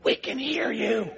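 slow, sweetly teasing call; playfully loving singsong; mock-loving. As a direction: gently let them know they're overheard, drawn out. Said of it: "I just lovingly said".

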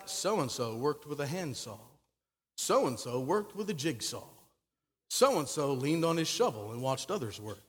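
low, dull recital of a tedious list; phone-book monotone. Acted. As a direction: drone it out like tedious record-keeping. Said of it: "reads kinda like a phone book".

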